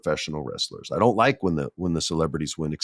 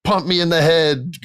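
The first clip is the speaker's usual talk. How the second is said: much higher, pumped-up fanboy cheer; imitating a fan overjoyed to get hit; eager fan; 9 semitones higher than usual. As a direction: beg for the hit like a thrilled fan. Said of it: "You'd be like".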